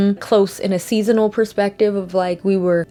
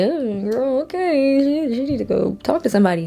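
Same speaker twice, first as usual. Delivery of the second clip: mock dismissive; 4 semitones higher than usual. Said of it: "people start to be like".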